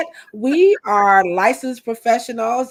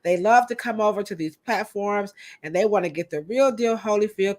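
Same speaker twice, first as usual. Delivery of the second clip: ordinary talk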